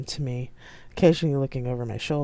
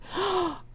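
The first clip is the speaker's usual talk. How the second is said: high shocked gasp; 4 dB quieter than usual. A narrator's reading. Ibo gives a screech.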